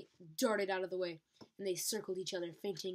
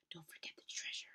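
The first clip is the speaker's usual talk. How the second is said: urgent hissed aside, softer; hissed whisper; 11 dB quieter, faster than usual. Acted. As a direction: hiss the reminder under your breath. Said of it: "Tsunami hissed".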